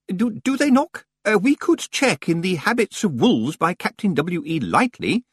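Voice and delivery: high, faltering bookish voice; hesitant senior librarian. Acted. Senior librarian wondering about wolves, high and hesitant. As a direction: stammer out a scholarly suggestion.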